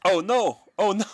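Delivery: high, loud cry of dismay. Real, not acted. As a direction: cry out at a sudden setback. Dismay at a mistake in game.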